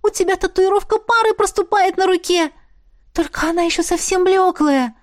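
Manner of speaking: put-on high, excited girlish chatter; delighted squeal. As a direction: squeal it out, thrilled. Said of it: "Восторженный писк Беаты".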